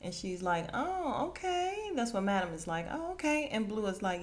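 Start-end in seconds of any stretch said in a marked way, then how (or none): 0.7-1.9 high, lilting mock approval; sing-song high "oh, okay"; intrigued oh-okay. Acting out an impressed reaction. 2.9-3.5 high mock-approving lilt; intrigued oh-okay. Repeats Madam's impressed reaction.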